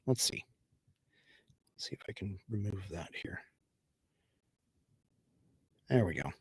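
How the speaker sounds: whispered to himself while fiddling; whispering. Real, not acted. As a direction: mutter it under your breath, unvoiced. Fiddling with stream settings, muttering.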